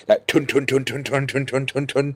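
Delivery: rhythmic tun tun gun bursts; imitated gunfire. Shown as a demonstration. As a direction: mimic a World War Two rifle. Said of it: "would sound a bit like this kind of thing".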